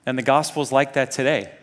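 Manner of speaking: normal talking voice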